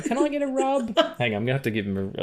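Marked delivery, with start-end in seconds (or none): 0.0-0.9 very high, squeaky cat voice; voicing the cat. The cat lying belly-up, asking in a tiny high voice. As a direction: speak for the cat, begging sweetly. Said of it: "staring at me with his belly out going".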